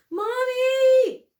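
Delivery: high piercing scream for mom; screaming for mommy. A narrator's reading. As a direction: scream for your mother in terror. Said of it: "sobbing and gasping for breath".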